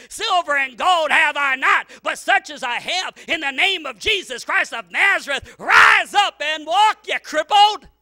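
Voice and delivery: booming, slow, very loud; shouted healing command. Acted. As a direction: roar it like an apostle commanding a healing.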